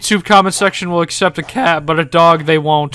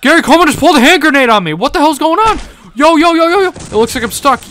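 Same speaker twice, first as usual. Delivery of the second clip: high, loud, fast disbelief; excited; 11 semitones higher, 7 dB louder than usual. Real, not acted. A gamer reacting to Gary Coleman pulling a grenade, loud and fast. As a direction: shout out in shock.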